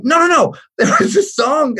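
high, urgent protest; high-pitched mock pleading; imitated insistent kid. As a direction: protest like a spooked son to Dad. Imitates his insistent, spooked son.

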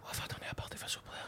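Partick Thistle whispered to himself; hushed, fast whisper. Real, not acted. Wondering aloud to himself.

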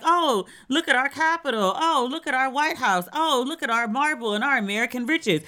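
lightly hammed-up boastful host; mock proud American host. American host showing off the Capitol to visitors, mock proud. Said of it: "be like".

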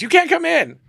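put-on high, loud teary girl's voice; crying model. Imitating the crying model refusing him entry. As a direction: play the upset girl refusing. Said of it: "She goes".

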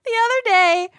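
laughing. Real, not acted. Laughing while starting anecdote.

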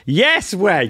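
playful retort. Real, not acted.

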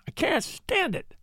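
high, slow, strained despair; despairing cry. Acted. Hummel to Spurstow, at the end of his rope, despairing. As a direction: let it crack upward in despair.

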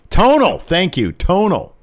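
excited exclamation. Real, not acted. Calling out the word delightedly.

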